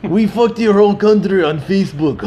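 lightly voiced gloating Russian; mock Russian hacker. Mocking Russians hacking America, light gloat. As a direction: gloat a bit, playing the hacker. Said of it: "mocking Russian hackers".

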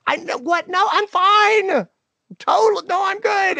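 high, forced-bright "I'm fine"; fake cheerful reassurance. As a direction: put on a brave, too-cheery front. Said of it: "I'm lying to her face".

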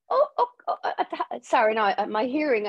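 high, stammering shock breaking into laughter; flustered disbelief. Retold or imitated. Stunned author stammering at the shop's huge order, high and flustered. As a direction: babble like you can't believe your ears.

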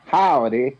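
mock Texan-style American accent; bad American accent. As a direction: do an exaggerated American podcast host accent. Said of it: "in this very bad American accent".

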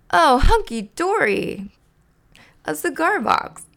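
high, loud, drawn-out delight; thrilled and high-pitched; delighted exclamation. Acted. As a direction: exclaim over a wonderful gift. Bert opens his present.

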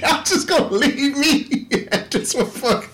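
high, laughing disbelief; laughing complaint. Retold or imitated. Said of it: "I was like".

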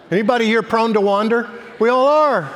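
high, loud preaching call; preaching at full force. Acted. Pastor challenging the congregation, high and loud. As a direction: lift the room with the question.

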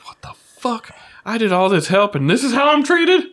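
high, loud indignant complaint; furious. Acted. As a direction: vent at the betrayal. GM voices furious tied-up Mal.

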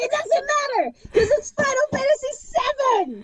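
high, teary mock whine; fake-cry it like a fan overwhelmed; mock wail about the game; mock sobbing plea. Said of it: "he's like".

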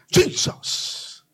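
high whispered cheer of Jesus; a fond high whispered call of the name; Brother Dan's cheer. Retold or imitated. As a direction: imitate a cheering old friend, high and hushed. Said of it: "he'd be going".

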